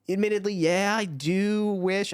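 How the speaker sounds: high, drawn-out hedging; reluctant admission. Retold or imitated. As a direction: ease into it slowly, pitched high and wary. Reluctantly admitting opinion under pushing.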